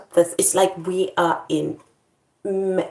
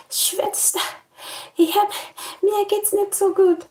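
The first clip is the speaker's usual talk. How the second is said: high feeble mock plea; mock-fainting plea to the sister. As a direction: play it weak and faint. Nurse quoting herself weak.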